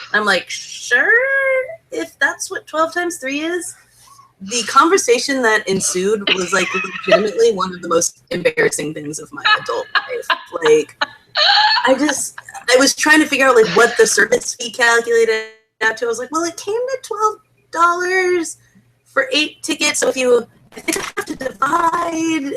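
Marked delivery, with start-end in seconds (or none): 0.5-3.7 higher, drawn-out hesitant reply; tentative, questioning lilt; unsure agreement. Retold or imitated. Quotes own unsure reply, questioning. 16.3-22.5 unsure, questioning lilt; confused figuring. Retold or imitated. Acts out own confused math.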